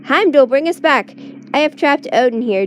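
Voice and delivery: high commanding shout. A narrator's reading. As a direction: call out across the distance. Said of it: "and shouts".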